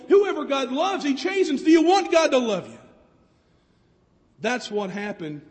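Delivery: ordinary talk, nothing marked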